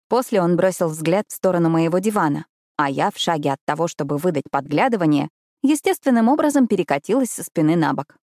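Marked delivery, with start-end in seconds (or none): none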